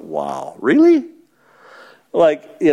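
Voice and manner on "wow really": incredulous. Retold or imitated. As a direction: roll your eyes and say it doubtfully. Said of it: "must have just kinda rolled his eyes and went".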